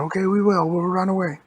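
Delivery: high, loud acted reply; hurried agreement. Hero answering police who say get out, high loud voice. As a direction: agree loudly and eagerly to flee.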